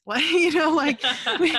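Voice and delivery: words squeezed out through laughter; giggling through the story; laughing. Real, not acted. Laughing while telling cat story.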